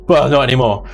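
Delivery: ordinary delivery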